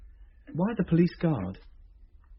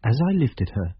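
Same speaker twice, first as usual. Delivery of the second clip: measured first-person narrator voice; story narration; 5 semitones lower, 4 dB louder than usual. A narrator's reading. Tom narrating the discovery of the ring, story narration. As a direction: tell it like a radio story, steady and grave.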